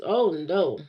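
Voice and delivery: ordinary talk, nothing marked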